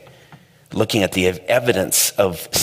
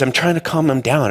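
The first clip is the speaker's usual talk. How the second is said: ordinary talk, nothing marked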